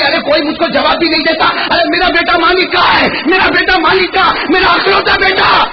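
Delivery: اونچی ہوتی ہوئی پکار، آخر تک چیخ; بوڑھی ماں کی طرح بیٹے کو پکارتے ہوئے آواز بڑھاتے جائیں; چیختی ہوئی ماں. Acted. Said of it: "چلا رہی، چیخ رہی". (بڑھتی ہوئی بلند پکار، بیٹے کی تلاش میں)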